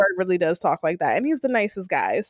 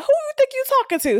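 much higher, fast sassy mom scold; mock scolding mother; faster than usual. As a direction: snap it back like an offended mother. Mother scolding her son.

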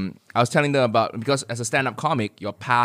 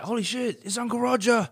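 surprised fan; 12 semitones higher than usual. Retold or imitated. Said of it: "it's like".

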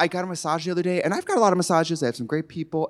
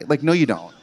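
ordinary talk

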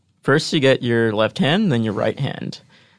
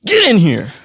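big shouted hug call, higher; loud call; 8 semitones higher, 8 dB louder than usual. Acted. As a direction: yell it like grabbing someone into a hug. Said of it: "maybe it'll sound like".

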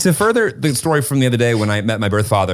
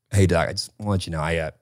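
calm, quiet and matter-of-fact; softly even, like it's nothing; real calm; 6 semitones lower, 7 dB quieter, faster than usual. Retold or imitated. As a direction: tell the doctor calmly, no fuss. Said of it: "I said, real calm".

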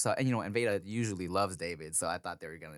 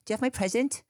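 put-on high little-kid question; Veda; 12 semitones higher, faster than usual. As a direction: imitate an expectant small child, pitched up. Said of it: "She's like".

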